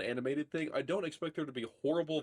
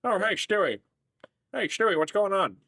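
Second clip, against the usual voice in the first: high, loud put-on cartoon voice; cartoon impression; 5 semitones higher, 5 dB louder than usual. Friend doing impressions for the podcast, high hammed-up character voice. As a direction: do a pitched-up cartoon character greeting. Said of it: "I don't wanna do any impressions".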